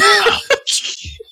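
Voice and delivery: high breathy mock moan of someone finishing; teasing impression of an orgasm noise; mock climax noise. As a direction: imitate the sound someone made at the climax, hammed up. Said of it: "They went".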